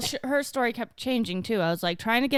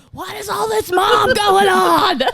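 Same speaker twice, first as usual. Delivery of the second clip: high loud slow yell through laughter; laughing yell; 9 semitones higher, 8 dB louder, slower than usual. Retold or imitated. Mom retells yelling at the kids, laughing loud. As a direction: yell it while cracking up. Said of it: "I did yell".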